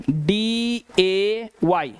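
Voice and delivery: slow, spelled letter by letter डी ए वाई; teacher spelling a word for students; spelling slowly. Shown as a demonstration. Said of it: "वाई का उच्चारण क्या निकल रहा है".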